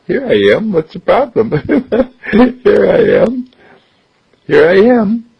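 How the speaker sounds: high, loud playful dog voice; the dog teasing, happy to be back; dog's cheerful voice. Acted. Duke answering Philip cheerfully, high and loud.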